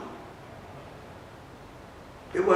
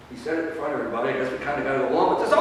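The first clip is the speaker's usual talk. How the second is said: grudging prophets; 7 semitones lower, 5 dB quieter, much faster than usual. Acted. Prophets grudgingly muttering to each other.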